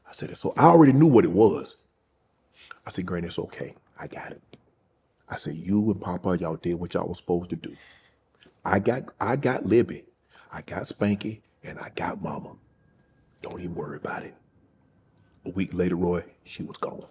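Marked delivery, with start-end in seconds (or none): 3.0-14.3 much softer, gently reassuring retold words; hushed comfort to grandmother. Comforting his dying grandmother in the retelling, very soft. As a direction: say it nearly breathless, soft as at a bedside.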